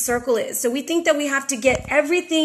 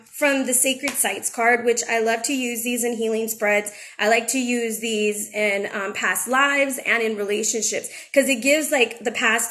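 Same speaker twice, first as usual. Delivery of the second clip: usual speaking voice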